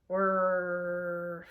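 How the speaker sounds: droning whirr; flaps whirr. Pretend. Flaps go up after landing, droning whirr. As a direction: drone it like a motor.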